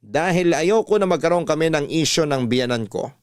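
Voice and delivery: usual speaking voice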